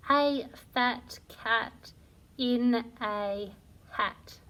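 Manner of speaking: slow, word by word; slow reading aloud. Shown as a demonstration. Teacher reading the ditty slowly to children. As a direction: read each word slowly and clearly for beginners. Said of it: "I'm going to read it for you now".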